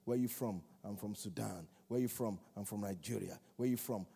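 ordinary talk, nothing marked

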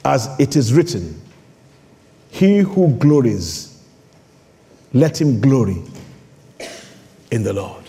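slow, low-pitched verse reading; solemn scripture reading. Real, not acted. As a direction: read it gravely, phrase by phrase.

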